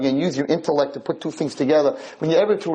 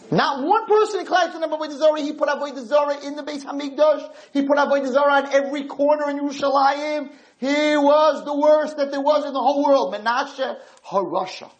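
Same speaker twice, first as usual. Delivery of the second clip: high, carried preaching voice, hammered out; fiery preaching; 11 semitones higher than usual. Acted. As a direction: preach it with rising outrage. Preaching about Menashe's idols.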